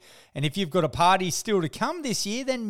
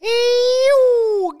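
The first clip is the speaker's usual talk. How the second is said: high squeal; 13 semitones higher, 10 dB louder than usual. Acted. High squeal opening greeting.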